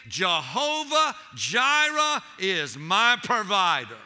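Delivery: slow, loud declaration; slow proclamation. Real, not acted. Preacher proclaiming God's provision to the saints, slow and loud. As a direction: proclaim it slowly and loudly.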